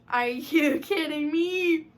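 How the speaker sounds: high, loud, drawn-out fangirl outburst; swooning disbelief. Real, not acted. Fan reacting to Tom Holland's picture, swooning loudly. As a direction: stretch it out in delighted disbelief.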